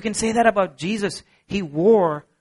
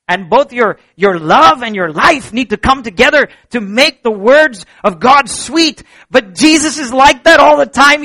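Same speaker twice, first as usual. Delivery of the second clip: loud, high, driving sermon climax; rising fervent pulpit voice; full-force preaching; 7 semitones higher, 10 dB louder than usual. Acted. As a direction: preach it out to the whole room.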